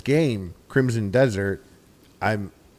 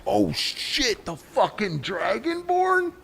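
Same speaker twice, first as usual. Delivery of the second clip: high, slow, awed guard voice; starstruck NPC; 10 semitones higher, much slower than usual. Pretend. Streamer imagines Skyrim guards amazed in a high voice. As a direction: gasp it out like a guard seeing the Dragonborn. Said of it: "they're never like".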